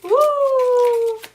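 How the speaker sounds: loud high whoop, real; high whooping laugh. Real, not acted. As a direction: whoop with delight.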